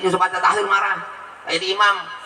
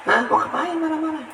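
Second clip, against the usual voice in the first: mock exasperation; 8 semitones higher than usual. Mocking angry people online.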